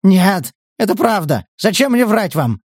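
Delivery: high, loud, desperate protest; frantic pleading. A narrator's reading. Scout facing a drawn knife, frantic pleading.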